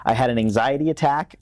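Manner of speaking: usual speaking voice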